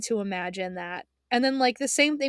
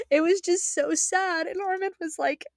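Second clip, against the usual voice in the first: tearful; 8 semitones higher than usual. Real, not acted. Fan still crying over the ending, tearful. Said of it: "It's making me cry again".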